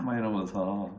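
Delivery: ordinary talk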